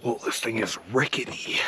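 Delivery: nervous whisper; hushed, careful words. Real, not acted. Climbing rickety tower.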